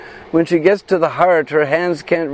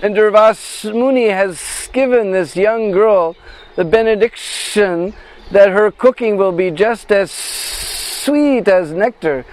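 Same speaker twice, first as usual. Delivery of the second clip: very slow, higher serpent voice with long pauses; snake voice; 4 semitones higher, slower than usual. Acted. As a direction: draw out each phrase like the snake explaining its plan. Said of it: "imitate the voice of that snake".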